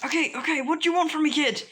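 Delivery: high-pitched, flustered question; panicked giving-in. Acted. Scared sludge villain bargaining with the kid, panicked. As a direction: cave in fast, voice jumping up.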